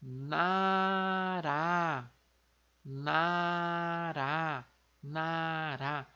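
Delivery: "nara" com R de uma só batida, como em arara; coreano nara. Shown as a demonstration. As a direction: diga "nara" devagar, R simples. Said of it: "País vai ficar". (pronúncia coreana de "nara", devagar)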